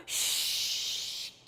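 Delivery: unvoiced hissing gust of wind. Storyteller making the wind's hiss for children. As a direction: whoosh like a storm wind over the sea.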